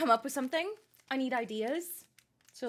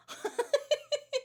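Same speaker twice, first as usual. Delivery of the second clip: high giggle; 13 semitones higher than usual. Real, not acted. Laughing at dab.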